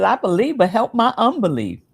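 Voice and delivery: high, loud acted plea; fervent plea. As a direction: cry it out as a heartfelt appeal. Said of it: "he says".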